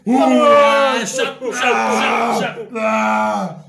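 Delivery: loud, high beach-party whooping; mock party chant, shouted high; rowdy party re-enactment. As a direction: whoop it up like drunken friends on holiday. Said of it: "¿pueden recrear la, la escena de".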